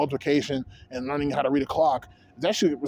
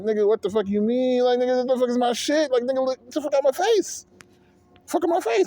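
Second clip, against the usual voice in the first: high-pitched mock indignation; acted outrage; 8 semitones higher than usual. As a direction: pitch climbing into indignant disbelief.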